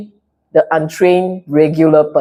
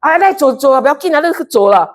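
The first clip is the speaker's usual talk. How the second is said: mock self-righteous tolerance; 7 semitones higher, much faster than usual. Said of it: "there will be a part of you say".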